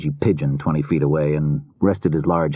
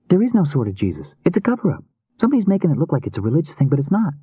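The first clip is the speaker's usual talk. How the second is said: put-on high, girlish voice, insisting; insistent young woman; 11 semitones higher, faster than usual. A narrator's reading. Rune tells Healy it's a cover-up, insistent young woman. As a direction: argue the theory in a high voice.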